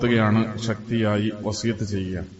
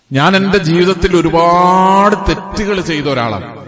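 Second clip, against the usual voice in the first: higher-pitched preacher's confession with stretched key words; raised, emphatic sermon delivery; impassioned preaching; 6 semitones higher than usual. Acted.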